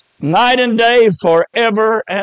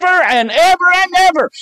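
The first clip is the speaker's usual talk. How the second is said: preaching climax; 10 semitones higher than usual. Real, not acted. Preacher's climax on eternal torment.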